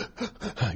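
a toddler's catching sob gasp, imitated. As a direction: mimic a child's deep sobbing intake of breath. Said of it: "he had that deep".

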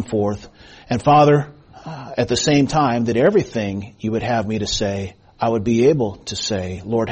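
low, measured pastoral prayer. Real, not acted. Leads the congregation in prayer.

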